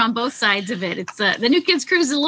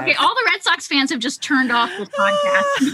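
a higher, half-laughing cry of mock despair; mock outcry; 7 semitones higher than usual. Real, not acted. Teasing the guest about the Red Sox slip, in a mock wail. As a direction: play up the horror at the blunder.

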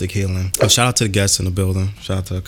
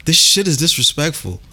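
ranting; 9 semitones higher than usual. Real, not acted. Ranting about cold.

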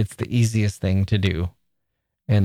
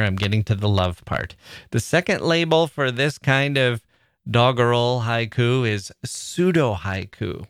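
ordinary talk, nothing marked

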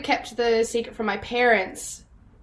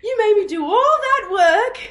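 high-pitched, indignant imitation of mum; mock outrage; 10 semitones higher, 4 dB louder than usual. Mum scolds daughter over needless accent research, mock outrage. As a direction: protest in a high, scandalised voice.

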